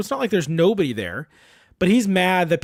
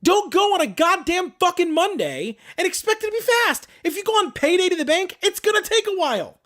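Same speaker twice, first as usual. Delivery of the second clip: high-pitched, emphatic scolding; worked-up, incredulous telling-off; exasperated rant; 11 semitones higher than usual. Real, not acted. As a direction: rant in a much higher voice, hammering each point. Ranting at impatient people in lines.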